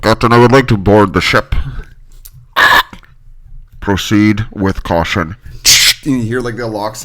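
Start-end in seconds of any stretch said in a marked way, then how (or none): 0.0-1.5 loud, formal request over a ship intercom; mock intercom announcement. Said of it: "on an intercom that he had installed". 3.8-5.4 slow, lower captain voice on an intercom; mock captain's reply. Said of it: "on an intercom that he had installed".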